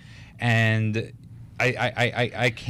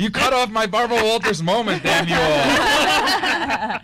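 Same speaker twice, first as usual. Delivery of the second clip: mock outrage; 10 semitones higher, 5 dB louder, slower than usual. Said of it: "I'm about to cry".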